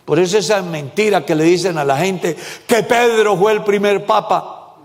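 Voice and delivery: normal talking voice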